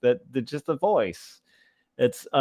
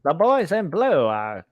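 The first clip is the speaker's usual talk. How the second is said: higher, Transatlantic newsreel announcer voice; mock old-timey newsreel read with a Transatlantic accent; 6 semitones higher than usual. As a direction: boom it out like a wartime newsreel narrator. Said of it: "Like that kind of thing".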